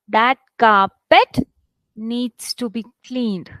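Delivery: deliberate two-part teacher's read; stress demo. Shown as a demonstration. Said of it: "let me read it for you".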